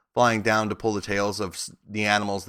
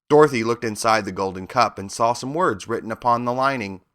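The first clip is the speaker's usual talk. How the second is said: ordinary delivery, nothing marked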